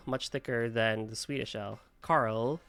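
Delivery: usual speaking voice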